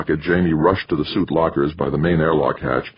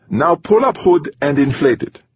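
put-on higher-pitched Middle European accent; 12 semitones higher, 4 dB louder than usual. A narrator's reading. Safety officer announcing herself to a colleague, Middle European accent. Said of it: "in her Middle European accent".

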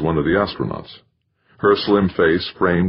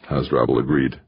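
ordinary delivery, nothing marked

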